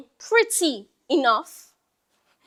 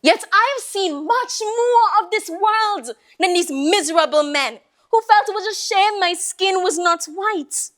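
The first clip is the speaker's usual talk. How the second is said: ordinary talk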